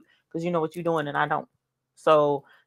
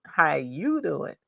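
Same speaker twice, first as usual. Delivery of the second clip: drawn-out greeting; 4 dB quieter, much slower than usual. Real, not acted. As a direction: draw out each word. Repeated greeting in chat.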